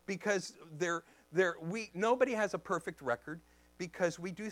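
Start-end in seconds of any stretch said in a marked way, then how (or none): none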